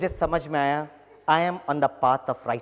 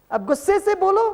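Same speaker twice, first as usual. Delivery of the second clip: mock angry. Shown as a demonstration. Teacher demonstrating an angry tone. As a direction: act out speaking in anger, pitched way up.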